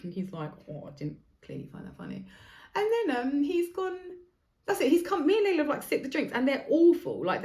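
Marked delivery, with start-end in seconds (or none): 0.7-2.2 soft, breathy deadpan mumble; unamused waiter. Retold or imitated. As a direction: mutter it, clearly not amused. Waiter voiced flat and quiet.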